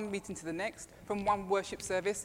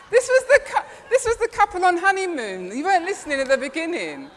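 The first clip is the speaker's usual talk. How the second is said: laughing; 8 semitones higher, 10 dB louder than usual. Real, not acted. Preacher laughing at her own mistake with the congregation. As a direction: laugh through the words, louder and higher.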